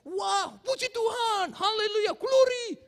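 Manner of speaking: loud, high, drawn-out hallelujah cries; mock ecstatic praise. Preacher mimicking worshippers, ecstatic loud praise. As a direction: ham up a charismatic shout of praise.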